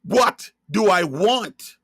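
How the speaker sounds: usual speaking voice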